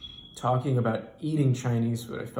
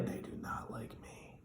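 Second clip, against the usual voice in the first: secretive whisper; 16 dB quieter than usual. Retold or imitated. Driver confides about Chinese bosses.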